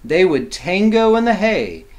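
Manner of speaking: lively, sing-song picture-book reading; reading to children. A narrator's reading. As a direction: read it like bedtime rhyme for kids.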